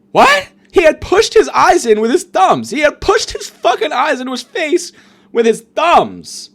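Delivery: high, loud incredulous outburst; appalled disbelief. Acted. Comic aghast at patient's injury, loud disbelief. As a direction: yell it out, aghast.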